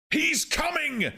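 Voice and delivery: slow, very high-pitched yell. A narrator's reading. Man announcing the approaching being, high drawn-out yell. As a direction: yell it high, stretching the words. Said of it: "yelled Jim Bokanovsky".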